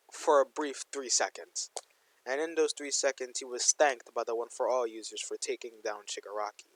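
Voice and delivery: usual speaking voice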